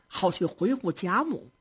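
ordinary delivery

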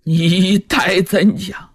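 浑身发抖，结结巴巴，放慢语速; 颤抖着一字一字逼问; 颤声发问. A narrator's reading. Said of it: "罗成浑身颤抖". (罗成颤抖着追问父王之事)

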